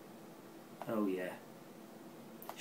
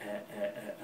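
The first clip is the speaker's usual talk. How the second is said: ordinary talk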